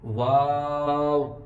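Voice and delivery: slow, long-held واو; sustained demonstration of واو; practice-model واو. Shown as a demonstration. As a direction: stretch the vowel of واو, rounding at the end. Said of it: "واو پہ بھی تھوڑی سی آپ نے practice".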